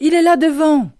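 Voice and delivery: high, loud excited call; shouting. A narrator's reading. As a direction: shout it ahead, pointing the way. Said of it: "ai-je crié".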